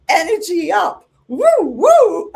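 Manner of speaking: high, loud whooping holler; full-blast mock hype shout; high-voltage shout. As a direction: imitate an over-energetic client shouting.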